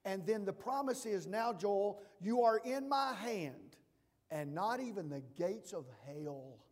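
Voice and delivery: loud, impassioned pulpit cry; preaching at full force. Acted. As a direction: give it everything, all in. Preaching God's promise.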